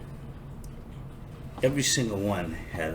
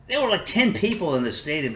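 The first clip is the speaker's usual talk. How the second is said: incredulous; 9 semitones higher, 4 dB louder than usual. Real, not acted. Exaggerating empty stadium.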